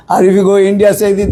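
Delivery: normal talking voice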